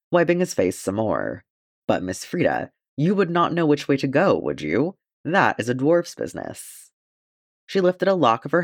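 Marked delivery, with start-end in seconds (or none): none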